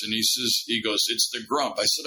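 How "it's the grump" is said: ordinary talk, nothing marked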